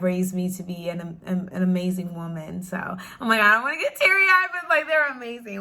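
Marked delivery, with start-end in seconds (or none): 3.4-5.6 blurting it out while tears rise; about to cry. Real, not acted.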